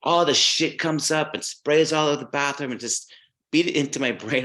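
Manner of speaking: amateur mock-Scottish rant; shitty Scottish accent. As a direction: lay it down in a poor Scottish accent. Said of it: "this is my shitty Scottish accent".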